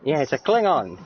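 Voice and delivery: normal talking voice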